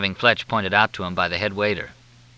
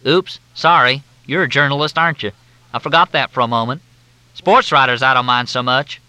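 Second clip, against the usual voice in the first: high, loud, syrupy Southern drawl; Southern officer on the phone, in a thick drawl; 5 semitones higher, 5 dB louder than usual. Acted. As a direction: drawl it out like a courteous Southern major. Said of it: "The drawl was thicker than Mississippi mud".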